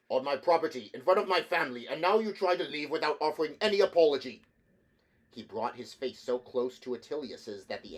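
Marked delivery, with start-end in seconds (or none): 0.1-4.4 loud, high and fast outrage; furious owner berating the engineer in front of his family; furious tirade. A narrator's reading. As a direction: berate him without pause.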